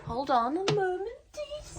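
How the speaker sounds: high, loud sing-song call. Real, not acted. Vlogger searching her table, sing-song high call.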